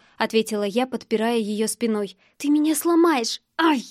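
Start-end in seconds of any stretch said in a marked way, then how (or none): none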